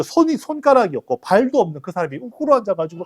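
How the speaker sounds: ordinary delivery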